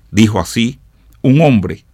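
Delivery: ordinary delivery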